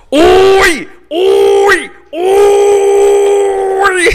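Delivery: high shouted uy, far voice; Fox commentator shout. Retold or imitated. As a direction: yell like a TV announcer. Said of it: "Pero si hubiera sido en Fox".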